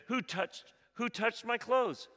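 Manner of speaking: a lightly voiced Jesus turning in the crowd; Jesus asking. A narrator's reading. Jesus asks the crowd, lightly voiced, searching. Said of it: "turned around in the crowd and said".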